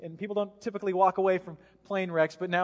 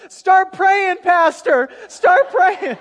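high, very loud imitation of a terrified passenger; panicked scream; 11 semitones higher, 14 dB louder, much slower than usual. Lady screaming to the pastor in turbulence, panicked shout. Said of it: "she screams out".

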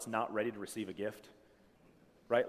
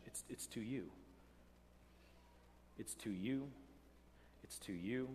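quiet emphasis; 4 semitones lower, 16 dB quieter than usual. Real, not acted.

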